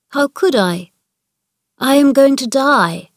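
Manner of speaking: emphatic, with a touch of pride; almost proud. A narrator's reading. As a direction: announce it as if half proud. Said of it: "He almost sounded proud of it".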